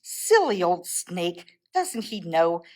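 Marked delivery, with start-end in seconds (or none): none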